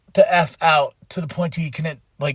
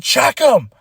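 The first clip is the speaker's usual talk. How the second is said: raised, louder urgent call to the ref; half-acted urgent ref call; imitating the wrestler; 7 semitones higher, 5 dB louder than usual. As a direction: mimic him calling out to check on his opponent. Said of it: "He's like".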